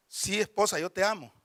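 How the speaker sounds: low, soft routine reassurance; imitated husband. Husband answering his wife's question, quiet imitated reassurance. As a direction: answer softly as a lightly voiced husband. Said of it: "la esposa le diga al esposo".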